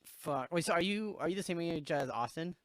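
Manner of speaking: ordinary talk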